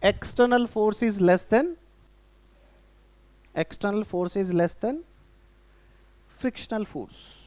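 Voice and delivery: very slow, word by word for writing down; slow dictation. Shown as a demonstration. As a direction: dictate each word slowly so they can write. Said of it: "बोलो क्या लिखोगे?". (धीमे-धीमे लिखवाना)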